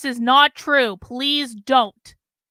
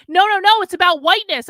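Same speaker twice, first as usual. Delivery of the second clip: mock pushy interviewer; 5 semitones higher, 7 dB louder than usual. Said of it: "And he said".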